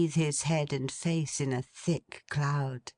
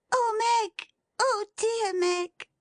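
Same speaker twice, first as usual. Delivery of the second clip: put-on sobbing child. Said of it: "in a burst of sobbing".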